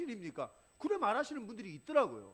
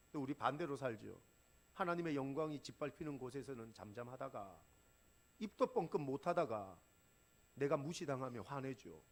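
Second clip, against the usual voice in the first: ordinary talk, nothing marked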